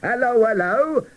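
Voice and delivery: cheery cracker greeting trapped dolls; bright breezy voice. Acted. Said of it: "said the cracker".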